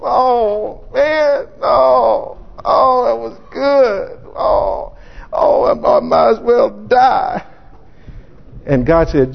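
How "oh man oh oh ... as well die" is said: hammed-up sobbing lament, high and breaking; mock wailing Jonah. Preacher acting Jonah grieving his gourd, mock crying. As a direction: wail over the dead plant like a sulking child. Jonah mourns the dead plant.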